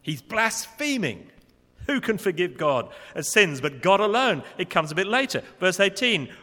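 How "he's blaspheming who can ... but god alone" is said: loud, high, slow outraged accusation; accusatory. Retold or imitated. Pharisees accusing Jesus of blasphemy, loud and accusatory. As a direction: hurl the blasphemy charge. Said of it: "It was there in the early part of the reading".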